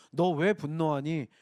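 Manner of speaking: low, slow, caring question; warm questioning. Acted. God speaking to angry Cain, warm questioning. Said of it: "굉장히 따뜻하게 말씀하시는 것처럼 들려요".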